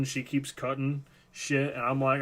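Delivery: ordinary delivery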